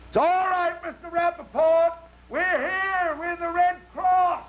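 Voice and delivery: high, loud drawn-out call; hollering. Acted. Rescuer calling to a lost man across a chasm, hollering. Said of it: "he hollers".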